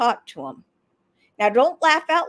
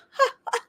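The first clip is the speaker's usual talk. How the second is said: very high little ha-ha; token fake laugh; 12 semitones higher than usual. Shown as a demonstration. Showing an isolated laugh an hour later, token laugh. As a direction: squeeze out a tiny mock laugh, high up. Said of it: "an hour later you go".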